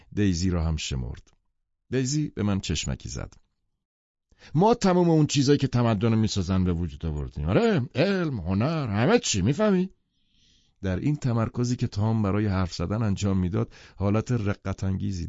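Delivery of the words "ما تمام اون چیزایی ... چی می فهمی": Tom's bragging voice. Acted. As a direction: boast it like a proud bully. Tom voiced in read novel. (صدای بلند و پرمدعای تام)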